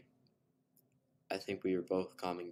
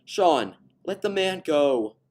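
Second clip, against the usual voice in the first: much higher, louder, slow; mother's stern command; 10 semitones higher, 15 dB louder, slower than usual. A narrator's reading. Wife telling her husband to release the man, stern loud command. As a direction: firmly order him to let go. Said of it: "a woman said from the car".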